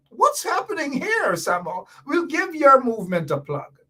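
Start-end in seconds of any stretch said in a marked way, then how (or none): 0.1-1.9 puzzled wondering. Retold or imitated. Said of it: "we're gonna wonder".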